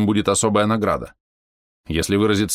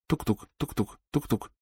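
soft thumping тук-тук; heartbeat imitation; 4 semitones higher, 8 dB quieter than usual. A narrator's reading. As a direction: mimic a heartbeat quietly. (тихо изображая стук сердца)

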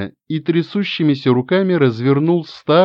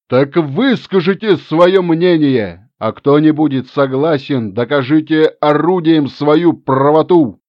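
rousing shout; 4 dB louder than usual. Acted. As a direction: stir the crowd to revolt. Final call to uprising. (призыв к восстанию)

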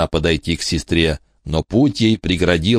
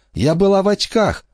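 Annie. A narrator's reading. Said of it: "напомнила Анни".

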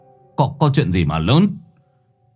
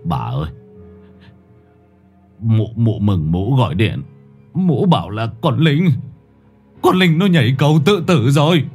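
stammering in shock; 3 semitones higher than usual. A narrator's reading. Old man tells his wife their daughter jumped, stammering in shock. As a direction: stumble over the words, then break. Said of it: "miệng lắp bắp".

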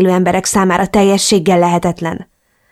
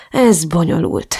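slow, weary sigh through the words; a tired sigh; slower than usual. A narrator's reading. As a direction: let it out on a heavy breath. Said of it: "sóhajtott mélyet".

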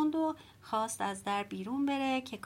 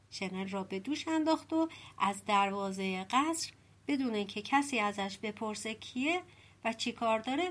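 plain reading voice

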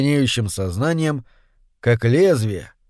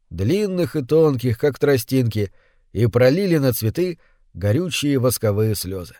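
ordinary delivery, nothing marked

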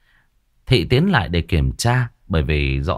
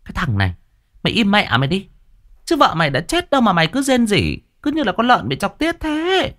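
shrill, much higher telling-off; scolding neighbor; 12 semitones higher than usual. Acted. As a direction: scold him like an exasperated old woman. Neighbor scolds wailing husband.

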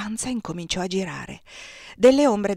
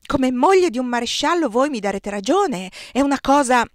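louder and higher, rising grudge; swelling resentment; 4 semitones higher, 6 dB louder than usual. A narrator's reading. The marshal's wife turns on Désirée, swelling with resentment. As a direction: press her grievance harder each phrase. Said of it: "si gonfiò di rancore".

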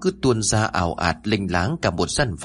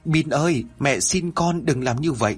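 desperate pleading; 5 semitones higher than usual. Acted. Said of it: "Tôi gào thét trong vô vọng".

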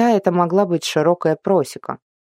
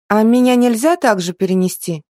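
eager; 6 semitones higher, 4 dB louder than usual. A narrator's reading. Girl asks eagerly.

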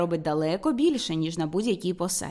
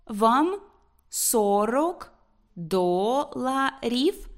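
put-on very slow, halting child reader, each syllable stretched and rising; reading syllable by syllable; 3 semitones higher, much slower than usual. A narrator's reading. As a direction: sound out every syllable with pauses between. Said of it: "по складах читала Тереза".

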